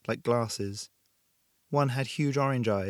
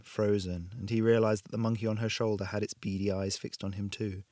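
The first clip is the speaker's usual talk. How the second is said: ordinary delivery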